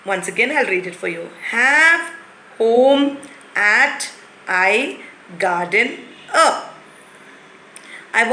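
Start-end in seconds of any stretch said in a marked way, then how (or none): 1.5-6.6 slow jumbled reading. Shown as a demonstration. Teacher slowly reads the jumbled words to the class. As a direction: read each jumbled word slowly and separately. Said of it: "If somebody talks to you like that way".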